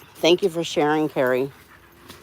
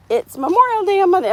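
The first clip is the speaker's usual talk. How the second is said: higher, fast chirpy mock of the doctor; retelling the doctor's cheerful reminder, high quick mock; imitating the doctor; 12 semitones higher, faster than usual. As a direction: toss it off brightly as Sue did. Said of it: "She's like".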